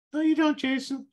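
mock yourself in a tiny doubting voice; subconscious voice. Shown as a demonstration. Coach voicing his doubting subconscious, squeaky and scoffing. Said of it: "use my little funny voices".

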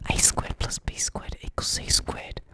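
hushed whisper of the formula. Acted. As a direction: breathe it out as a whisper. Reading answer in whisper.

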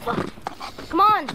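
urgent shout. Acted. Urgent escape to helicopter.

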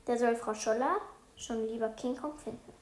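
ordinary delivery, nothing marked

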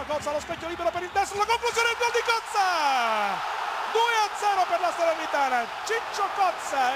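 shouting the goal, pitch soaring; breathless Italian goal commentary. Real, not acted. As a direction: build fast, then explode on the goal. Live goal scored.

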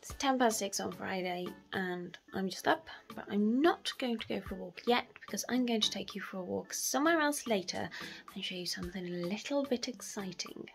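normal talking voice

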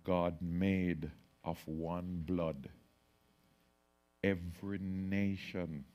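hushed, reverent scripture reading; solemn recitation. Acted. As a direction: intone the verse slowly, almost to yourself.

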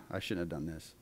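very soft mock remorse; regretful. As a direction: drop it to a guilty murmur. Soft parent regretting, giving in.

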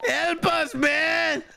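high mock whine; mocking the partner's whine in a high voice; mocking plea.

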